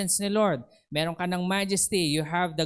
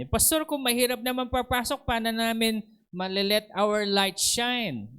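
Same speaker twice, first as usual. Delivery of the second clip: higher mock complaining member; whiny church member; 7 semitones higher than usual. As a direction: play a member grumbling that it's too hard. Member complaining about strict entry.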